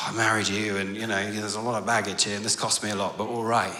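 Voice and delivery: weary sigh, then low grumbling resignation; grudging acceptance. Acted. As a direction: sigh and concede it reluctantly. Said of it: "He's not thinking".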